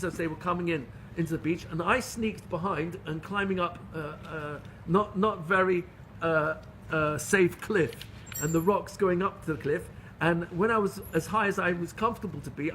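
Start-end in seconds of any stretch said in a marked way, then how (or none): none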